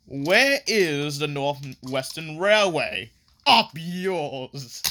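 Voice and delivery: slow, put-on villain voice; hammed-up goon demanding directions; rude diesel engines. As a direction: play a rude henchman, drawn out. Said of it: "he meets up with these diesels".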